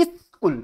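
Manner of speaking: স্কুল broken in two as "is-kul"; syllable demonstration. Shown as a demonstration. Teacher showing students how to count syllables, স্কুল split as "is-kul". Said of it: "আমরা কটা পেলাম? দুটো syllable পেলাম".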